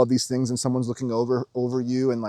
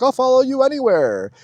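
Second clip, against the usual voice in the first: much higher, louder pleading; hammed-up frantic disciple; Peter, pleading; 12 semitones higher, 4 dB louder than usual. Peter begs Jesus, higher and louder pleading. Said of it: "And Peter's like".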